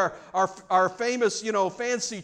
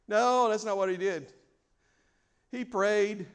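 ordinary talk

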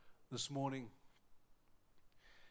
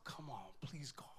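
hushed, whispered prayer; soft, barely voiced begging; inward plea; 8 dB quieter than usual. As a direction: plead with God under your breath. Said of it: "I thought to myself".

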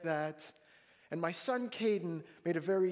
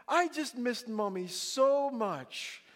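slow, higher imitation of a child; sweet little boy; 6 semitones higher, 3 dB louder, much slower than usual. Son making excuses while hugging Mommy, imitated sweetness.

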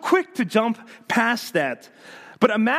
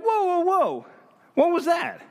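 high-pitched, halting someone in disbelief; mock-indignant objection, voice raised in pitch; taken aback; 12 semitones higher than usual. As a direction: pull them up short, pitched well up. Acted confrontation of a glib apology.

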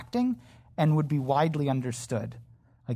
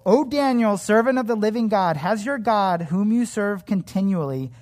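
loud, higher desperate calling out; anguished call; 5 semitones higher, 4 dB louder than usual. A narrator's reading. King calls to Daniel in the den, loud and anguished. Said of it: "he cried out in a tone of anguish".

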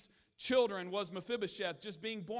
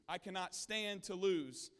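ordinary talk

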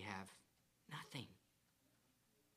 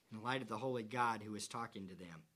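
ordinary talk

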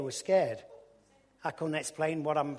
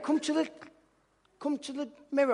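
much higher, laughing wife voice; urgent wife; 11 semitones higher, 4 dB louder than usual. Retold or imitated. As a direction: play the wife hurrying him. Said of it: "She went".

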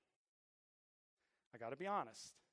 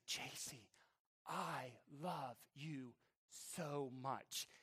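soft, very slow, loving murmur; tender reassurance; 2 semitones lower, 5 dB quieter, much slower than usual. Retold or imitated. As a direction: retell a father soothing his child. Comforts his crying son.

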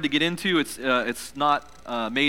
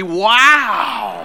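amazed; 3 semitones higher, 7 dB louder than usual. Shown as a demonstration. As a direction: gasp it out in awe. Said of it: "say it like you're really amazed".

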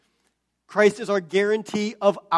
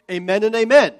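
second AMEN punched louder; emphatic doubling; 7 dB louder than usual. Retold or imitated. Preacher quoting the psalmist's doubled word, emphatic. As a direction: land hard on the repeated "amen". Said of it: "They're emphasizing what's being said".

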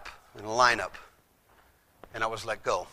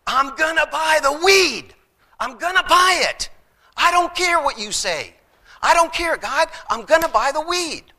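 high, loud, defiant shouting back; arguing with God; 7 dB louder than usual. Retold or imitated. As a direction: stubbornly yell your refusal at God. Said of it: "And I was arguing with God".

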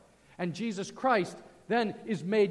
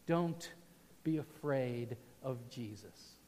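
reassuring; 7 semitones lower, 6 dB quieter than usual. Real, not acted. Pastor reassures the congregation.

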